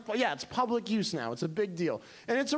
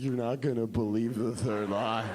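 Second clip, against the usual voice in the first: much lower, teasing hype; teasing Mildred; 9 semitones lower than usual. Retold or imitated. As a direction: tease the big secret low. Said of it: "she's like".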